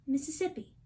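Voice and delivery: usual speaking voice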